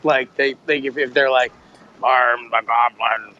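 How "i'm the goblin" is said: mock goblin voice. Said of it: "if they wanted to do a voice".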